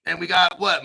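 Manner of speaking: ordinary talk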